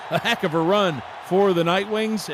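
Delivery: high, loud breakaway-run call; thrilled sportscaster in full cry; excited play-by-play. Real, not acted. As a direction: shout the run as it unfolds, then ease slightly.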